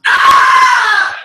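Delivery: very high, very loud scream; sudden scream. Acted. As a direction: let out a piercing comic scream. Screams out in the bit.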